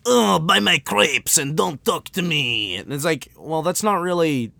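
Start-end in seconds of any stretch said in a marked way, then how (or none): 0.0-2.8 slow, higher, dismissive Frenchman caricature; haughty French-accented grumble; mock French accent. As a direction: brush them off in a thick French accent. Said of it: "But the French are like".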